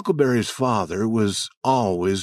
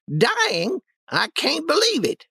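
put-on alarmed old lady. Aunt Polly alarmed.

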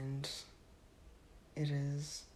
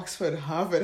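ordinary delivery

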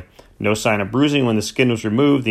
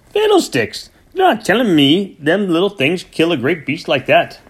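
much higher, loud scoffing disbelief; furious farmer scoffing; indignant farmer; 6 semitones higher, 3 dB louder than usual. Acted. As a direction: scoff at the vet in outrage. Cranford scoffs at diagnosis.

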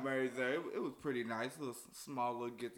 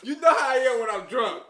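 high, very loud outburst; loud admission; 9 semitones higher, 15 dB louder than usual. Real, not acted. Groom owning up to friends about being drunk, loud outburst. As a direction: call it out over the laughter. Calls out over friends' laughter.

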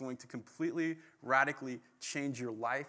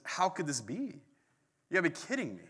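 disbelieving, voice jumping higher; incredulous; 4 semitones higher than usual. Retold or imitated. Zechariah answering the angel, scoffing in disbelief. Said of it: "but his first response is".

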